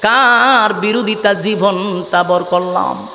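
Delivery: low, slow chanted lament; hypocrites' murmured regret. Acted. Hypocrites asking each other whom they opposed, low chanted regret. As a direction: draw the words out low in a rueful singsong. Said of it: "বলা বলি করতে লাগলো".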